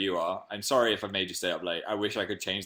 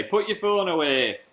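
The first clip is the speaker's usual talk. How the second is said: higher, drawn-out mock parent voice; nagging parents; 7 semitones higher, 3 dB louder, slower than usual. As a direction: put on pleading, scolding parents. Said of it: "My parents would say".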